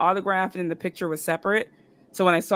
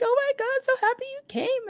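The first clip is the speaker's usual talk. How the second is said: very high gushing imitation; gushing excitement. Star thanking the fan for coming, gushing. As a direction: pitch it way up, overjoyed for her.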